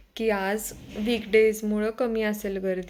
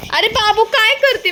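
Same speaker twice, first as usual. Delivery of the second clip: surprised call; 12 semitones higher, 13 dB louder than usual. Real, not acted. Mother spotting her son doing something silly, surprised shout. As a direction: catch him in the act, surprised.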